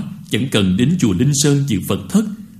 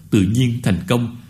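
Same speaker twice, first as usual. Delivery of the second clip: ordinary talk, nothing marked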